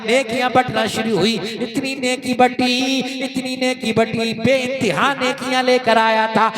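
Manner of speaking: loud ringing sermon delivery, sustained pitch; full-force preaching. Acted. Preacher warns oppressors of the day of reckoning, full-force preaching. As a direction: preach it out to the whole congregation.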